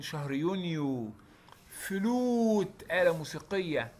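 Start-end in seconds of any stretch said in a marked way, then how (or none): 1.7-2.8 "فلوت" with a long drawn-out oo. Shown as a demonstration. Teacher drawing out the long u in "فلوت". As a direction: stretch the oo in "فلوت". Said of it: "طويلة شوية".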